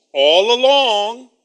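slow, loud mocking drawl of a sister's reply; sarcastic. Sister answering the Master sarcastically, slow and loud. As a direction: Martha drags it out with heavy sarcasm. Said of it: "So now we have sarcasm".

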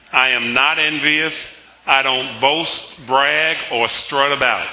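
declamatory, deliberate group recitation; congregational confession. Acted. Pastor leading the church in its confession, emphatic recitation.

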